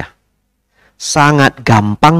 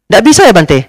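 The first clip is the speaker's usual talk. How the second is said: high, loud pleading question; pressing lady; 9 semitones higher, 5 dB louder than usual. Retold or imitated. Pushy lady tries once more, high and loud. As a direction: push once more for a yes.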